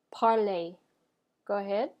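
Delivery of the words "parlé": "parler" as "par-lay", final R silent; French pronunciation. Shown as a demonstration. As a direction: model "parler" with its ending rhyming with play. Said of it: "pronounce this last example with me".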